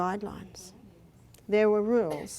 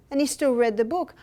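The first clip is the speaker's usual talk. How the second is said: ordinary delivery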